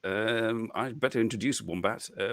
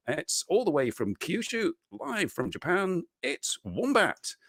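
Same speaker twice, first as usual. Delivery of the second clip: announcer intro; 9 semitones higher, slower than usual. Acted. Host announcing the guest.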